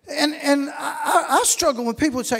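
ordinary talk

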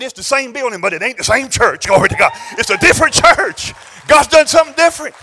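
loud, high preaching shout. Real, not acted. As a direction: shout the victory to the crowd. Preacher's climactic shouted answer.

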